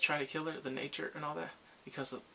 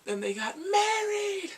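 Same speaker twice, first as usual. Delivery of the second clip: high, loud burst of delight; excited squeal; 7 dB louder, slower than usual. Real, not acted. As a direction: squeal the last word, drawn out. Thrilled retelling of the wedding.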